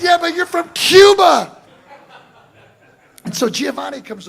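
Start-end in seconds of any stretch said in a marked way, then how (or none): 0.0-1.6 higher, louder comeback shouted across the band; shouted retort. Retold or imitated. Shouting back at Horacio across the loud jam, higher and louder. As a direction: throw the punchline back loudly over the music.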